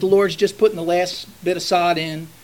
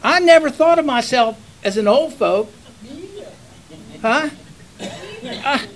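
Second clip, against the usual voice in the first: normal talking voice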